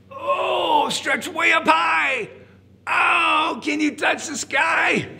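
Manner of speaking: high, loud, drawn-out urging; straining stretch call. Pretend. Leading children in stretching up, high loud call.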